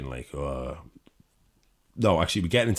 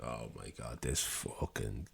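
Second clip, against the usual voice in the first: low, soft exasperated grumble under her breath; annoyed girlfriend grumbling quietly beside her snoring man; hushed disgust; 7 semitones lower, 17 dB quieter, slower than usual. Retold or imitated. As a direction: mutter it so the plane can't hear. Girlfriend muttering at snoring boyfriend.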